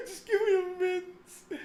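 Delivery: mock mincing plea. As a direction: whine it daintily. Mock voice demanding mince.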